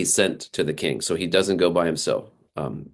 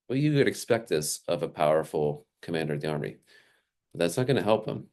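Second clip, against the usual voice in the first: ordinary talk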